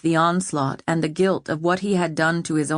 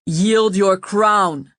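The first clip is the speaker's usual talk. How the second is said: slow, intense, louder demand; passionate demand; 2 semitones higher, 5 dB louder, much slower than usual. A narrator's reading. Owen demanding the crown, passionate and slow. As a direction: lean on every word with conviction. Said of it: "Owen said passionately".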